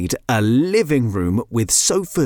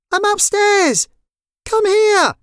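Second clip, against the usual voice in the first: very high, louder shout; calling out; 4 dB louder, slower than usual. Acted. Neve calls to her sister from upstairs, calling out. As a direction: call down from another floor.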